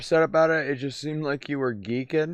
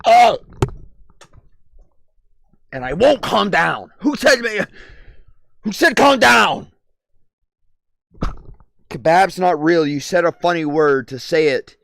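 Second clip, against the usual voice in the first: shouting; 5 semitones higher, 10 dB louder than usual. Real, not acted. Heated yelling at chat about kebab.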